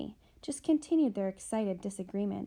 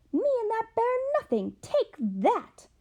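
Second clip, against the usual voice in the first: much higher, loud, then striking; older sister hits back at the younger; furious retort; 13 semitones higher, 6 dB louder than usual. Acted. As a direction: lash out in a fury.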